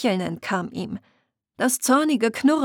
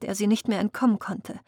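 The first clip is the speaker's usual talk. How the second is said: ordinary delivery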